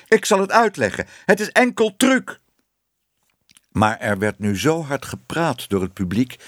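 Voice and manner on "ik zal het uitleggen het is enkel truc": high, strained-pitch outcry; shouted protest. A narrator's reading. Exposed man tries explaining to the audience, shouting high. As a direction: plead your case in a high shout. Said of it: "riep meneer Ellemeet".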